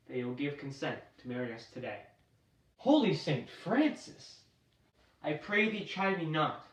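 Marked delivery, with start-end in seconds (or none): 2.8-4.4 high, slow astonished cry; shocked exclamation. Acted. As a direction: gasp it out in disbelief. Friar shocked by Romeo's change.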